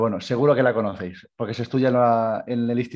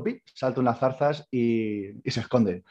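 usual speaking voice

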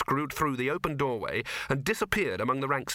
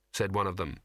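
ordinary delivery, nothing marked

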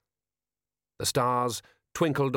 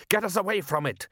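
high-pitched alarmed command; father's raised, pressing voice; urgent order; 9 semitones higher than usual. Acted. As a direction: urge your son to flee. Father urges escape.

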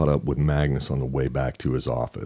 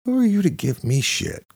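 higher, rising indignant defense; defensive outburst; 7 semitones higher than usual. A narrator's reading. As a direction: snap out of self-pity and bristle. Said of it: "snapping out of his self-pity, defending his life's work".

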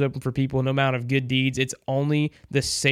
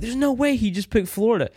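high incredulous quote, pitched way up; disbelief; 9 semitones higher than usual. Retold or imitated. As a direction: act out the shock of being robbed. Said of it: "and I was like".

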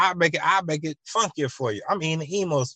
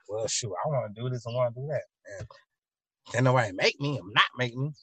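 softer, quick defiant muttering; stubborn muttering; 6 dB quieter, faster than usual. Retold or imitated. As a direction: mumble that nobody can make you. Retold helper's stubborn excuse.

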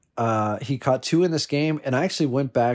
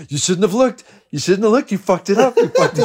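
taunting higher and louder, building to a squeal; mock scolding; 9 semitones higher, 6 dB louder than usual. Friend taunting him for looking, high mocking scold. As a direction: gloat like a friend calling karma. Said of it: "He's like".

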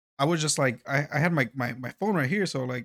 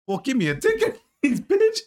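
higher and louder, laughing through it; laughing mock taunt; 12 semitones higher, 5 dB louder than usual. Retelling his comeback to the FAA agent, laughing mock taunt. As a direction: dare the agent to ticket you, half laughing.

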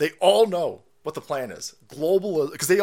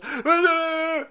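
high laugh; 13 semitones higher, 4 dB louder than usual. Real, not acted. Laughing at own joke.

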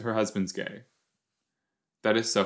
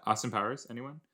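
ordinary talk, nothing marked

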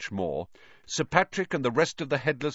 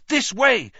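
loud, much higher urgent shout; 13 semitones higher, 8 dB louder than usual. Acted. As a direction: yell to your friends to follow. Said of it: "he shouted".

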